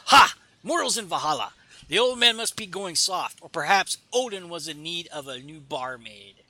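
lightly voiced jeering god; mocking Asgardian warrior. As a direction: sneer at the mortal like a swaggering Asgardian. Reading an Asgardian's comic dialogue.

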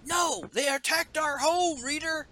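high, put-on heroine protest; mock-dramatic defiance, pitched high; defiant character voice. Acted defiant character in retold scene.

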